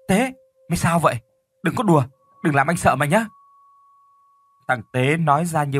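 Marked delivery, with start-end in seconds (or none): 0.1-3.3 higher-pitched, urgent brother's call; alarmed calling out. A narrator's reading. Older brother calls to his strangely growling brother, alarmed. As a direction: call to him in rising alarm. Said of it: "thì gọi nó".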